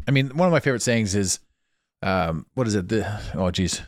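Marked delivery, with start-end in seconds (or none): none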